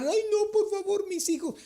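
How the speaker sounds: hammed-up tearful begging to keep the job; mock crying plea. Mocking a worker crying after layoff. (súplica llorona fingida, mucho más aguda)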